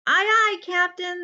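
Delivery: high, loud eager crew reply; toadlets chorus. Acted. The Toadlets answering their captain, high and loud. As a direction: chirp it like little sailors. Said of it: "the Toadlets agreed".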